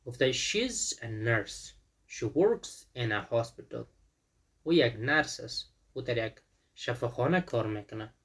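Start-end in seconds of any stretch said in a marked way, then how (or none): 0.3-3.8 she's a nurse, She works in a hospital said slowly, with works and hospital stressed; slow sentence rhythm. Shown as a demonstration. Teacher modelling sentence rhythm for the class, slow and stressed. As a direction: spell out the rhythm word by word, slowly. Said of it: "فشار رو ببینید".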